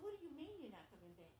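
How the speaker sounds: high, stunned disbelief; shocked question. Retold or imitated. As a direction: ask it in alarmed disbelief, voice jumping up. Nurse shocked by boy's words.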